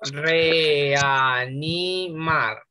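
Reanimar stretched out syllable by syllable, very slow; very slow syllabifying. Shown as a demonstration. Teacher spelling out syllables for the class, very slowly. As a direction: drag every syllable of Reanimar apart. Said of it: "sepárenla en sílabas".

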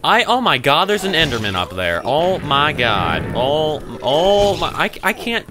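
high, loud wail of alarm; panicked. Real, not acted. Player spots an Enderman up there, panicked. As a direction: stretch the cries out in panic.

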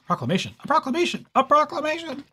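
rising giddy squeal; building from hushed to high excitement; excited. Acted. Wilbur excited about proclamation.

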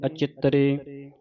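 slow, measured chanting of gurbani; recitation. Shown as a demonstration. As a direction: recite each line as a chant.

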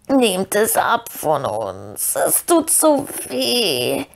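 very slow, drawn-out pleading wail; croaking Gollum. A narrator's reading. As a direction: beg in agony, stretching every word. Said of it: "krächzte Gollum".

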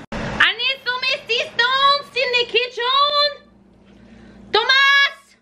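put-on high, slow French-accented call; mock French accent. Cook calling for help in the kitchen, high mock French call. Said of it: "kind of started off as French".

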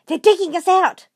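high, loud panicked cry; alarmed shout. Acted. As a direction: yell the danger to the family. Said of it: "he shouted".

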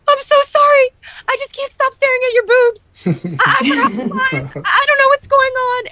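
very high-pitched mock panic; freaking out. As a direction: imitate a flustered, apologetic actress in a squeaky high voice. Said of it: "she freaks out".

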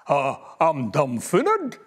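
slow, low, baffled rumble; a big beast scratching its head; bewildered gruffalo. A narrator's reading. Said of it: "said the gruffalo".

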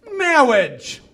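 mock-solemn wedding minister with mangled R; comic clergyman's speech impediment; Princess Bride minister. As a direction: intone it as a falling "mawwage". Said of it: "we snuck a Princess Bride reference".

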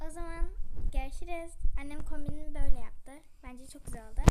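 loud, cheerful sign-off; excited outro. Real, not acted. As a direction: shout a happy goodbye to viewers.

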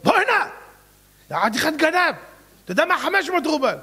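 high, loud call after him. Acted. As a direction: shout to stop him. Buyer calling out in story.

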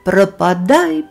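plain reading voice

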